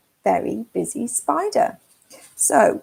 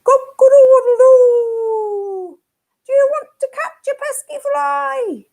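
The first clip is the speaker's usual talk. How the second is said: cockerel crow; 13 semitones higher, 7 dB louder than usual. Acted. Cockerel crowing at the spider, high and loud. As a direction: crow it out to wake the farm. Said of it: "what does the cockerel say?".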